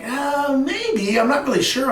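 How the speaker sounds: high-pitched, unsure hedging; hesitant doubt. Retold or imitated. Mentee answering mentor's revenue challenge, doubtfully. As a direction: waver upward while working out the math.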